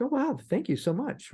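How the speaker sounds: high, gushing surprised thank-you; imitating a grateful surprised stranger, pitched high; mock shocked thanks. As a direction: act a stranger stunned by a gift. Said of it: "others will be shocked".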